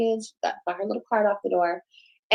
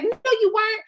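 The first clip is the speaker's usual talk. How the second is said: pitched-up retold denial; high mock denial; 12 semitones higher than usual. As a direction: snap back high like the caseworker. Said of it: "She's like".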